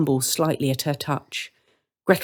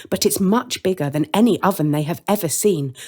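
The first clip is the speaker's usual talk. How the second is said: ordinary delivery, nothing marked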